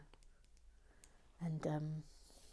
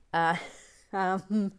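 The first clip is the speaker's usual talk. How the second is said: ordinary delivery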